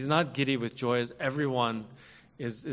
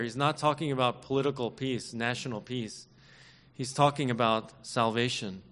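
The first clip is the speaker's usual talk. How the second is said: ordinary talk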